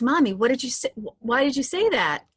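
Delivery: lightly voiced kid calling to mom; a hint of an insistent little girl; daughter. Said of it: "she goes".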